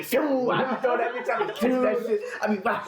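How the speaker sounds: high, laughing through the words. Real, not acted. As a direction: laugh while you say it. Laughing about tossing dough.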